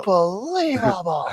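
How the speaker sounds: slow, drawn-out hype call; Gus Johnson hype. Pretend. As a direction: stretch it out like a hype man. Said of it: "Gus Johnson chimes in with the hype".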